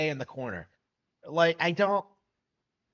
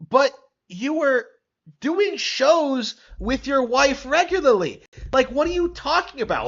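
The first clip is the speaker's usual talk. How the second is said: exasperated rebuttal; 10 semitones higher, 8 dB louder than usual. Real, not acted. Arguing back at the played clip.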